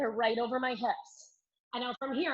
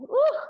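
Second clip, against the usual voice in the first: high, loud "ooh" of exertion; short strained whoop; effortful ooh; 13 semitones higher, 7 dB louder than usual. Real, not acted. Effort lowering from exercise.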